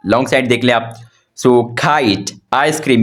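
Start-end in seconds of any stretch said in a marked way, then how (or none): none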